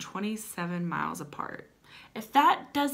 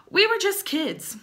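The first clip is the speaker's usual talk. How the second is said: high, louder mock plea; mock dramatic; 11 semitones higher, 6 dB louder than usual. Reading a lovesick ad aloud, hammed-up high plea. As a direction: overplay the line to mock it.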